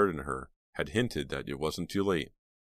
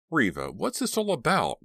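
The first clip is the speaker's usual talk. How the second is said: impatient, higher and louder; 11 semitones higher, 4 dB louder than usual. A narrator's reading. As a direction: press her for an answer, impatient. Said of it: "Mister Dalby asked impatiently".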